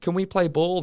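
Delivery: put-on high, eager kid voice; little boy voice. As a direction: ask like a small boy. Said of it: "from the boy's perspective".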